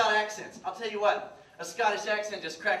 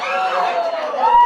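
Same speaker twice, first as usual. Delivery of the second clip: much higher and louder squeak; squeaky voice jump; 15 dB louder than usual. Acted. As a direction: let the voice jump up as a joke. Salesman's voice cracks high.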